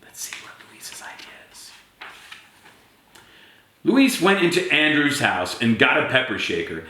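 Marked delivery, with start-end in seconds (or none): 0.0-1.7 whisper. Real, not acted. Storyteller teasing the children.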